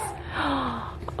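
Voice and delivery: sharp delighted gasp at a tiny crown. Real, not acted. Vlogger unboxing a tiny floral crown, delighted gasp. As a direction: gasp in real delight as the box opens.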